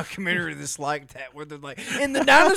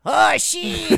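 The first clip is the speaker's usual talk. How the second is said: mock exclamation; 4 semitones higher, 5 dB louder than usual.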